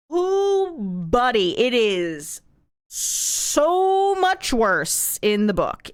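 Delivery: slow, stretched-out so much worse; dramatic drawn-out warning. Real, not acted. To chat, warning them the book is worse: slow emphatic stress. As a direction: lean hard on so, drag it out.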